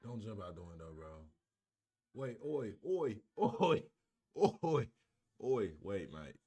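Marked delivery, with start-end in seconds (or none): 2.1-6.4 loud mock English "oi" calls; English accent. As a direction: shout it in a put-on English accent. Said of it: "Am I saying it in an English accent".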